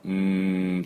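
long, level "mmm"; drawn-out thinking noise; pondering hum. Shown as a demonstration. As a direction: hum as if mulling it over. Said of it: "悩む時の".

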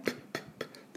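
声を出さずに唇で「プッ」と三回; スイカの種を飛ばすように短く息を破裂; 種を飛ばす音. Shown as a demonstration. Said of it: "スイカの種を飛ばす音ですね". (息だけのPの破裂音)

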